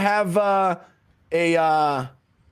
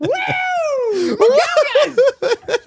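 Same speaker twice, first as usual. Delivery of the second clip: whooping, very high and loud, stretched; party yell; rowdy cheer; 6 dB louder, much slower than usual. Pretend. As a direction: holler like a wild spring-break crowd.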